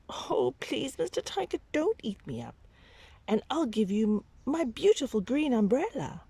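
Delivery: put-on child's voice, high and begging; high pleading child. Babaji pleads with the hungry tiger, high and begging. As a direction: plead for mercy in a little voice.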